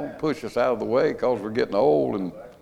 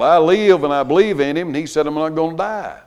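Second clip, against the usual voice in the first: rising preaching; 6 semitones higher, 6 dB louder than usual. Acted. Preaching builds.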